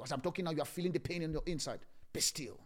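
normal talking voice

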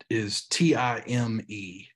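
"T I M E" spelled slowly letter by letter. Shown as a demonstration. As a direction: spell "T I M E" out, each letter separate.

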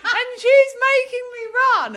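high, whiny cry for help mimicking her friend; mock helpless wail; mocking friend's plea. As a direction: squeal it like a helpless invalid.

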